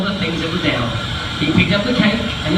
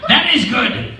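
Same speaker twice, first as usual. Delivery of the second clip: pleased fish; 7 semitones higher than usual. Acted. Fish approving the cat leaving, relieved. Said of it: "said the fish".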